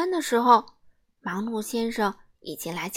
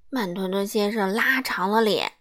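ordinary delivery

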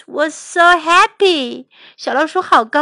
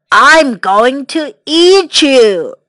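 loud, slow threatening lion; growing hungry menace, drawn out and loud; roaring lion; 6 dB louder, much slower than usual. Acted. Lion threatens the mouse who woke him, roaring. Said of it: "roared the lion".

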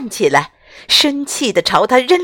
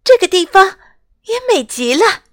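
admiring; 11 semitones higher, 3 dB louder than usual. Acted. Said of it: "安娜不禁赞叹".

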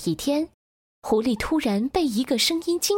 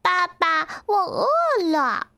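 put-on high, plaintive baby duckling; whining duckling; 7 semitones higher than usual. A narrator's reading. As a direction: whine to daddy for food in a high voice. Hungry duckling whines to fox. (小鸭子尖声撒娇喊饿)

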